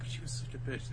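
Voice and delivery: mock whisper from the back; whispered jab. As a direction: sneak in a snide whisper. One person in back muttering aside.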